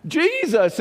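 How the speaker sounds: loud, high desperate cry. Retold or imitated. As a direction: yell the name to be heard. Blind man cries out.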